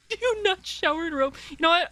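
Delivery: high teasing disbelief; mock incredulous. Real, not acted. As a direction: act shocked and tease. Teasing about robe showers.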